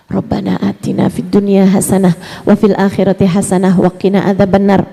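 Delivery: low, reverent prayer recitation; recited prayer. Shown as a demonstration. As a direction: intone it like a prayer. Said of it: "silahkan lafalkan". (doa yang dilantunkan rendah)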